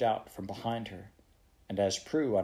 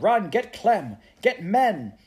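high, loud, urgent commands; ordering; 10 semitones higher, 8 dB louder, slower than usual. Acted. Prue sending Beth for men, urgent shouted orders. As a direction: fire off orders in a raised voice. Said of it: "she ordered".

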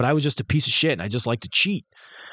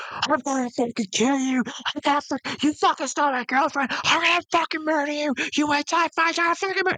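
mock screaming threat; 13 semitones higher than usual. Jealous ex threatening narrator on phone, high-pitched mock fury. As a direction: squeal out a crazed death threat. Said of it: "he was, like, screaming".

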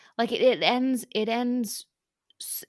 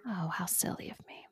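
mock self-scolding; 13 dB quieter than usual. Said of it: "mock self-scolding".

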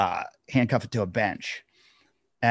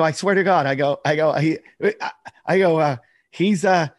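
frantic protest; 6 semitones higher, 5 dB louder than usual. Retold or imitated. Pleading with cop, laughing.

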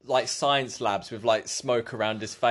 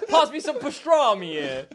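mock Italian accent; 12 semitones higher, 4 dB louder than usual. Imitating a deli customer.

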